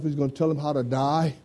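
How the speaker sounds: usual speaking voice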